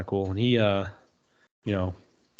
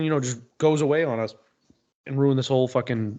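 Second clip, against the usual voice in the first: normal talking voice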